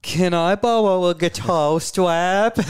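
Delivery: high, slow, embarrassed mock request; hammed-up sheepish plea; sheepish mock ask. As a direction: whine it like a hapless idiot.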